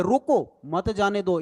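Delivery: रोको rising, a break, then मत जाने दो; pause after रोको. Shown as a demonstration. Teacher recalling the first version, pause straight after रोको. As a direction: split off रोको, keep मत with जाने दो. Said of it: "पहले लिखा था यहाँ पे".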